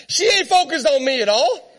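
ordinary delivery

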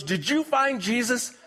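a hammed-up preacher at the end of his rope; aggravated preacher. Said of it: "Preacher was a little bit aggravated".

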